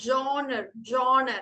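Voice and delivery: "Genre" as JON-ruh; model pronunciation. Shown as a demonstration. Teacher correcting Baiju's last word, carefully pronounced. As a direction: say "Genre" with an "o" vowel, repeated.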